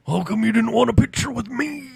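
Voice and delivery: high, put-on wrestler voice; Randy Savage impression. Wrestler holding up a startled child, Randy Savage impression. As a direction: play the wrestler confronting a tiny fan. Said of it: "turns me around to look at him".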